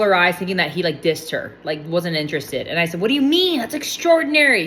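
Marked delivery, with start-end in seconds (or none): 3.0-4.7 retold disbelief, pitched higher; incredulous protest. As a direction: push back in amazement, voice jumping up. Retells protesting to her friend.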